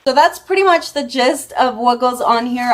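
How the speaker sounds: usual speaking voice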